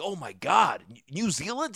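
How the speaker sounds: lightly mocked American accent, incredulous; American party-goer impression; imitated Americans. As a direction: imitate a baffled American, rising to a high question. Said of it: "all these Americans".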